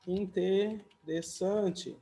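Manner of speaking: interessante stretched out slowly for the class; drawn-out teaching pronunciation. Shown as a demonstration.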